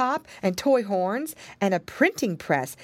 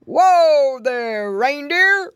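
loud, long-drawn holler, pitched up; big drawn-out call, rising at the end; 7 semitones higher, 9 dB louder, much slower than usual. A narrator's reading. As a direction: halt a reindeer team from afar. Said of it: "Then someone called".

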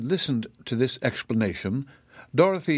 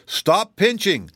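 high, louder, drawn-out protest; pained cry; 9 semitones higher, 5 dB louder, slower than usual. A narrator's reading. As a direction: cry out slowly at being pinched. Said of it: "cried the zebra".

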